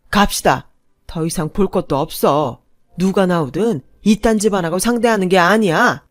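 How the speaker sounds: angry dismissal. Acted. Father storming out.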